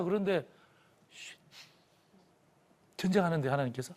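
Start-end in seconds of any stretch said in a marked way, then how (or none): 1.1-1.4 short "shh" for silence; hushing shush. Acted. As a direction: shush the room. 하나님의 조용하라는 신호 흉내. (조용히 하라는 쉿 소리)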